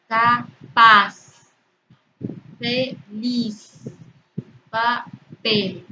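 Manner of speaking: "volver", "después", "capaz" split in two, final syllable punched; stress on last syllable. Shown as a demonstration. Teacher giving examples of agudas, last syllable stressed hard. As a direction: pause mid-word, then hit the last syllable. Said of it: "la última sílaba se pronuncia con mayor intensidad".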